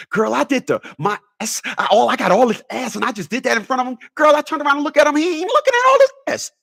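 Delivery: hammed-up girl gossiping, pitch climbing; woman complaining to girlfriend. Woman voiced telling her girlfriend.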